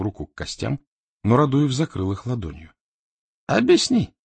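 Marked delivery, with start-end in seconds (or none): 3.5-4.1 high, loud demand. A narrator's reading. Raduev blocking the dice until he gets answers, demanding. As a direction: demand an answer, pitched sharply up. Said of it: "потребовал он".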